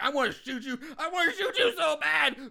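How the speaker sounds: laughing, rising into a shout; mock yell. Podcaster retelling the sheriff's rage, laughing mock shout. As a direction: yell it through laughter, half joking. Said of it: "He's like".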